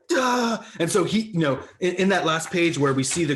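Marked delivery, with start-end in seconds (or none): 0.1-0.6 high-pitched startled yelp; mock frantic outburst; imitated panicked cry. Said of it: "And so he's just like".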